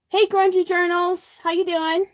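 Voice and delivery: bright high-pitched hello; cheery greeting. Real, not acted. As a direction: greet a friend warmly and brightly. Greeting a viewer joining the stream.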